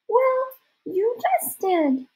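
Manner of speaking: very high, slow falsetto; a boy apologizing in a fake girl voice; drawn-out, dainty put-on squeak; disguised girl voice. A narrator's reading. Disguised boy answering back.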